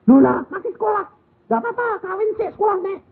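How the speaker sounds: put-on high, fast childlike protest; surprised child. Child tells grandma not to worry, high, fast. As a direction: protest like a surprised young kid. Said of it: "dua-dua anak bilang".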